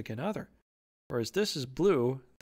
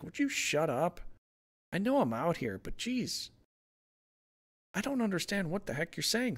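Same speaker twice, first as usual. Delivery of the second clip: mildly exasperated, higher at the end; pissed-off Moon; 4 semitones higher than usual. Acted. Moon telling the barking dogs to shut up, mild annoyance. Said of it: "look at how pissed off the Moon is like".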